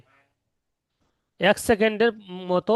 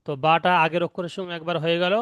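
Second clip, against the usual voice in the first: usual speaking voice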